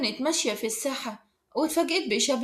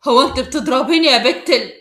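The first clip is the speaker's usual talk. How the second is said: loud, outraged challenge; furious disbelief at being hit, louder; angry; 8 dB louder than usual. A narrator's reading. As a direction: raise the voice in anger. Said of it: "خالد يقول بغضب".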